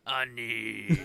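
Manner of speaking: put-on Watto-style accented call. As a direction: stretch the word out in Watto's accent. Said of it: "I can do that accent".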